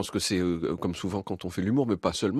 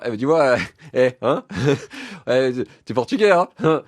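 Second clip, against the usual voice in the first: loud, higher-pitched imitation of a joker nudging; mock teasing; 4 semitones higher, 8 dB louder than usual. Comedian mimics people ribbing him for being Portuguese, loud mock teasing. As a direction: needle them playfully, punching each "eh" and "hein".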